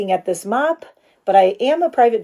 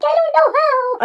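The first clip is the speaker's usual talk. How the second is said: much higher, squeaky mock-helpless voice; mock helpless squeak; 3 dB louder than usual. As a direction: play yourself as a helpless little kid. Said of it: "I'm like".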